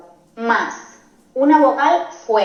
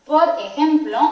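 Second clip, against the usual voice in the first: normal talking voice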